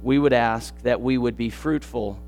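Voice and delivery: soft, low prayer voice; hushed closing prayer over music. Acted. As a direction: pray it quietly and humbly.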